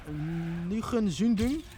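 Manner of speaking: Nüchensündung drawn out slowly in German; German word. Shown as a demonstration. Streamer reading a chat word, slow German pronunciation. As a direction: sound out Nüchensündung syllable by syllable in German. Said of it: "words to pronounce in German".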